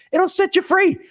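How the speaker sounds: soaring excitement. Real, not acted. Host pitching creative financing.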